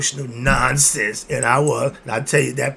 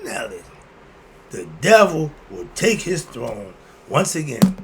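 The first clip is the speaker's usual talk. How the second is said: usual speaking voice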